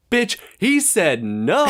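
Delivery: high, slow, drawn-out sassy heckle. Retold or imitated. Coworker heckles the dragged-down girl in the film, high, slow and sassy. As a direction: ham up a cheeky shout at the screen. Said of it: "he goes".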